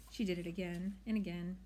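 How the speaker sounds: ordinary delivery, nothing marked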